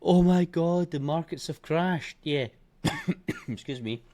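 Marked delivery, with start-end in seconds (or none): 0.0-2.2 mocking panicky traders, higher and louder on oh my God; hammed-up alarm, then drawn out slowly. Trader mocking last week's panicking crowd, mock panic. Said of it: "everyone last week was screaming about".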